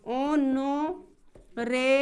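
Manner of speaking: Honourable stretched out very slowly, syllable by syllable; slow demonstration. Shown as a demonstration. Teacher spells out the sound for students, very slow. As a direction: draw out honourable so students hear each part. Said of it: "कौन rebel बोलते हैं?".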